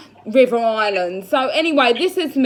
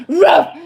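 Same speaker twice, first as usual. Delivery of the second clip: dog bark; 6 semitones higher, 8 dB louder than usual. Pretend. Fake bark while laughing.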